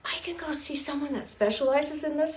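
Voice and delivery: high, eager realization; light up with new hope; excited. Retold or imitated. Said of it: "really excited".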